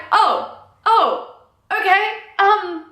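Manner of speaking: high, stammering, awkward offer of help; flustered boss. Retold or imitated. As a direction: play a flustered boss stumbling over the offer. Acting out boss's flustered offer.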